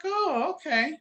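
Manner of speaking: imitated friend's reply. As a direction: quote her lightly, pitched well up.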